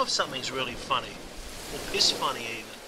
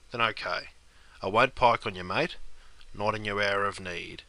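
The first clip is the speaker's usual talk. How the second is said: loyal mate; 8 semitones lower than usual. Acted.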